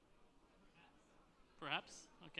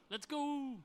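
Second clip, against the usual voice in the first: loud, higher rallying call; excited kickoff; 7 semitones higher, 6 dB louder than usual. Real, not acted. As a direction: cheer the next presenter on. Presenter kicks off the next talk.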